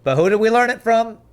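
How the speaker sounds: high, loud rhetorical challenge. Real, not acted. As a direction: rhetorical question thrown out loudly.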